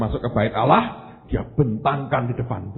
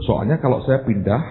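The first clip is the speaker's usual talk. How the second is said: ordinary talk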